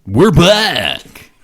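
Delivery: high, loud radio DJ shout; over-the-top radio host voice; wacky eighties DJ. Acted. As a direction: announce it like a zany eighties FM jock. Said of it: "I hate that".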